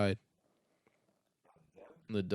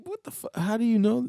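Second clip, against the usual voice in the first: higher, louder astonished dad; surprised dad; 6 dB louder than usual. Retold or imitated. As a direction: blurt it out in disbelief. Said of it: "he'll be like".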